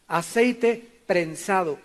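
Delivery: aceite prensado spelled out slowly, each syllable drawn out for note-taking; slow dictation. Shown as a demonstration. Pastor dictating to the congregation, slow and deliberate. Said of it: "escríbelo".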